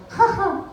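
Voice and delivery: put-on high-pitched little old-lady laugh; old lady's laugh. Old lady laughing mid-chat on a plane, high-pitched. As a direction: put on a tiny falsetto "ha ha". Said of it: "all of a sudden she goes".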